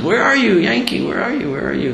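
high-pitched searching call, lightly acted. Grandfather calls for hidden crying grandson.

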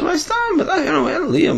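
high, laughing protest; laughing disbelief. Retold or imitated. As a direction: laugh it off in confusion. Man laughs in confusion.

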